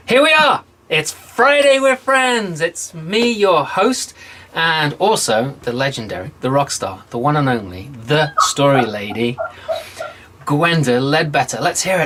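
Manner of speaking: big announcer welcome, stretching the key words; show host introduction. Acted. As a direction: kick off the show like a ringmaster.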